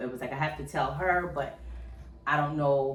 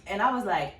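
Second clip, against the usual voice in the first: ordinary talk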